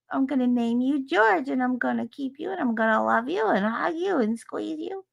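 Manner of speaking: put-on high, sing-song baby-talk; cutesy doting voice. Mocking a chatter, doting sing-song voice. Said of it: "She said".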